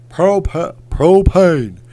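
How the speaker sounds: badly faked cartoon Texan, high and loud; loud attempts at a cartoon dad catchphrase; Hank Hill. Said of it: "Hank Hill's a hard one to do".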